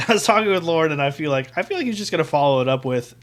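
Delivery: ordinary delivery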